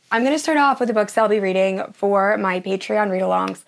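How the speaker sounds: normal talking voice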